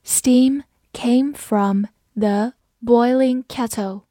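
slow, word by word with clear gaps; slow, careful model reading; slow read-through. Shown as a demonstration. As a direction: read it slowly for learners, each word separate. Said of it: "我们慢慢来读".

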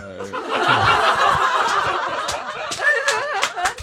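long high-pitched laughing fit; drawn-out falling laugh; laughing hard. Real, not acted. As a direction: crack up helplessly. Cracks up at the other's remark.

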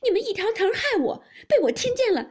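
fast, high hysterical shouting; screaming accusation. A narrator's reading. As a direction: scream at them as the wronged wife. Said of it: "叫道". (尖声哭闹叫喊)

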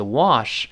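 wash with a wide open American mouth; 美音 wash，口型张大. Shown as a demonstration. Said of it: "美音口型大一些". (美音读 wash，口型大)

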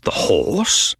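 puzzled echo, somewhat high; wondering, incredulous question; milder astonishment. A narrator's reading. Narrator echoes the astonishment.

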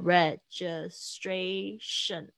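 "registration" slowly, each syllable apart. Shown as a demonstration. Teacher checks the syllables of "registration", slowly separated. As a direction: count out re-gi-stra-tion in "registration". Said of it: "应该有五个音节哦".

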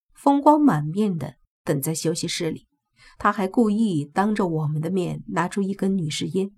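plain reading voice

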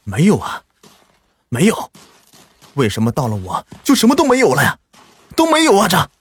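frantic, rising to a high desperate cry; frantic despair. A narrator's reading. Li Tiansheng, digging and finding nothing, cries out in frantic despair. 李天生 digs and finds nothing.

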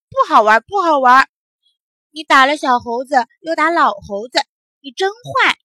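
put-on loud, emphatic children's reproach; indignant scolding. A narrator's reading. Other children blaming the boy for hitting both monkeys, indignantly. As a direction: tell him off sharply.

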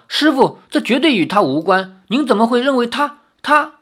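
urgent, high-pitched defence of someone; anxious disciple protesting to his master; hasty protest. A narrator's reading. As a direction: plead hurriedly, voice climbing in disbelief. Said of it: "连忙说".

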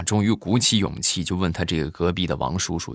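normal talking voice

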